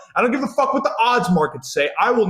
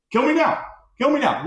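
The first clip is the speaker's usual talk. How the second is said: high, quick mock plea; mock despair; 9 semitones higher than usual. Fan repeating his message after rereading it, high mock despair. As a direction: toss out the misery in a raised pitch.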